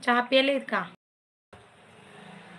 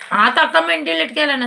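higher, louder scold; 5 semitones higher, 7 dB louder than usual. Real, not acted. Host scolding viewer for deleting comments, loud. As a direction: tell him off sharply.

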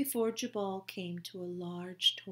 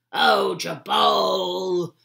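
put-on slow, loud, drawn-out groan of a grumpy old salt; grumpy captain groaning at the bullfrog, slow loud old salt; groaning old sailor; 2 semitones lower, 14 dB louder, much slower than usual. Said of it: "he groaned".

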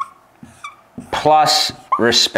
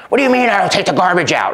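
defensive reacting; 6 semitones higher, faster than usual. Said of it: "That's reacting".

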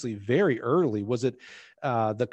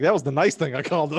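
teasing mock quote; 4 semitones higher, 6 dB louder than usual. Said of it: "You're like".

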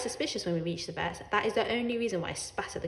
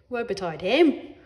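defiant; 6 dB louder than usual. A narrator's reading. Cat's retort.

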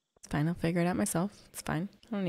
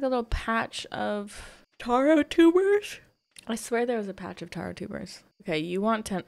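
ordinary talk

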